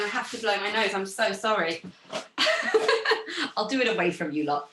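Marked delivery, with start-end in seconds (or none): 1.8-3.5 high-pitched burst of laughter; real high giggle of embarrassment; embarrassed laugh. Real, not acted. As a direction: laugh apologetically, high and sudden. Apologises for blowing her nose.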